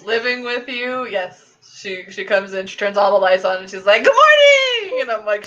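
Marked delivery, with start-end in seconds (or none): 4.0-5.0 very high, loud sing-out; hammed-up morning wake-up call; mock cheery greeting. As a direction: burst in imitating an overexcited morning person. Imitates housemate's bright morning entrance.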